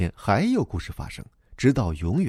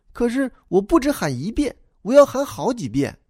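put-on high, childlike voice for the little boy; young boy replying to the grown-up, high and eager; boy's voice; 13 semitones higher, 4 dB louder than usual. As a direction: play the earnest schoolboy explaining himself. Boy Cao Dimin answers narrator.

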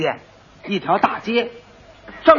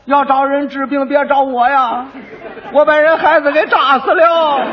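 street crying; 5 semitones higher, slower than usual. Acted. Punished quack parades, crying out to the townsfolk. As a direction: cry it out to the whole street. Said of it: "还得吆喝".

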